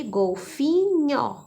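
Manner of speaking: golfinho stretched slowly, syllable by syllable; slow sounding out. Shown as a demonstration. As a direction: draw out golfinho to show the nhó. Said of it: "o nhó". (golfinho dita devagar, destacando o nhó)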